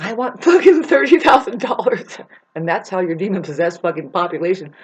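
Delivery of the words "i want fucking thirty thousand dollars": higher, louder mocking demand; greedy mock demand. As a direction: sneer at them as you demand the cash. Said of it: "They're like".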